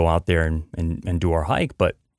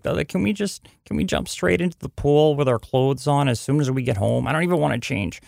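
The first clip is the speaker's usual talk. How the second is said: put-on higher, eager little girl asking; daughter's voice; 6 semitones higher than usual. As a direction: voice the five-year-old pleading to jump in. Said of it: "she's like".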